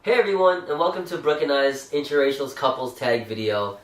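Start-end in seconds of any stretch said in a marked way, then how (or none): none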